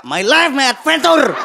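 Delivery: high, drawn-out triumphant shout; acted yell. Father riding a wrecked road with rice sack, acted yell. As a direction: bellow it slowly like an adventure slogan. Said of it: "sambil teriak".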